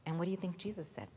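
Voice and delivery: plain reading voice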